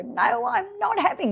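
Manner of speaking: high, upset protest from mum, imitated; mock dramatic mum. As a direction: play mum refusing the shower, high and upset. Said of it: "And she'd go".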